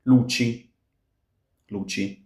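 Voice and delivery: "luci" with ch, LU-chi. Shown as a demonstration. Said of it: "gives us ch".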